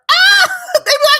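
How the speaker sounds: high loud laughing mock shriek; mock scream. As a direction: shriek like a terrified neighbor, laughing.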